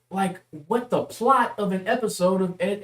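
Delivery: ordinary talk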